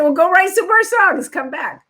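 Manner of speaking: imitating Clive Davis. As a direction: wave her off like a busy mogul.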